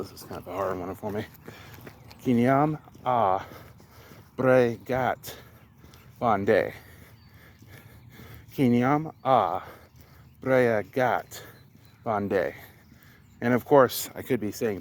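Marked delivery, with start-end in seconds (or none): 2.2-6.7 "Kinyam a bregat bonde" slow, word by word; slowly. Shown as a demonstration. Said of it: "I have to say this one kinda slow". 8.5-12.5 "Kinyam a bregat bonde" slow, word by word; slowly. Shown as a demonstration. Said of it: "I have to say this one kinda slow".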